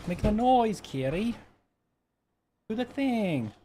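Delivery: sing-song high voice, coaxing the kitty; high coaxing pet talk. Real, not acted. Owner coaxing her cat to make its noise, high coaxing pet talk. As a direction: lift the voice way up and coax the cat.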